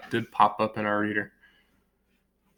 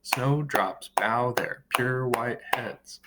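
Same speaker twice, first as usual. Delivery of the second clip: slow, measured count-along reading; syllable by syllable; 4 dB quieter, much slower than usual. Shown as a demonstration. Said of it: "How many syllables".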